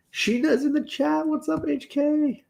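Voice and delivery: very high squeaky voice; squeaky high greeting. Real, not acted.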